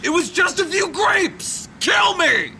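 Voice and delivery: loud, pained angry shout. Acted. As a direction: scream it in pain and rage. Shouting after second tase.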